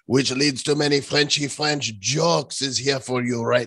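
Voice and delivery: hammed-up French accent; mock French accent. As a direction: put on a comic Frenchman's accent. French jokes introducing a French guest.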